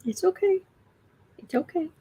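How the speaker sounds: high, soft coo to the cat; calm the cat with a high sweet voice; cooing reassurance to a pet; soothing the cat. Real, not acted.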